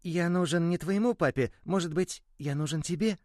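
high, begging toy voice rising; pleading little ball. Acted. The ball begs the boy to keep it, high and pleading. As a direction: beg the boy to believe you. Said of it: "умолял мячик".

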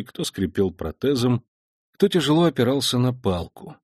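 ordinary delivery, nothing marked

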